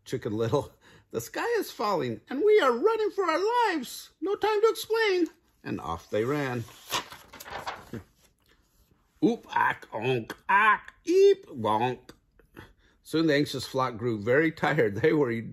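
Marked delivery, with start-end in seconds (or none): 1.1-5.3 very high, squeaky chick voice; frantic Chicken Little. A narrator's reading. Chicken Little warns the turkey, high frantic squeal. 9.2-12.1 assorted squawks leaping high and loud; cartoon pile-up of yelps and honks; bird collision noises. Birds tumble into each other, squawking and honking.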